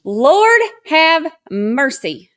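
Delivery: loud, slow, drawn-out cry; shocked exclamation. Real, not acted. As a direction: exclaim in disbelief, stretching each word. Reacts in shock to arrest clip.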